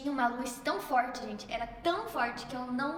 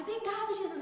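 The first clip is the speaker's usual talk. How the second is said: high-pitched, acted jubilation; overjoyed thanks; 7 semitones higher than usual. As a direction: cry out thanks as if jumping for joy. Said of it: "pular de felicidade".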